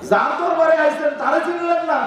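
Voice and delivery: high-pitched retold rebuke; mock scolding. Shopkeeper rebuking a visitor at the shrine, mock scolding. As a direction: act out the shopkeeper's indignant telling-off. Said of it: "দোকানদার আমার উল্টে ধমক দিয়ে কয়".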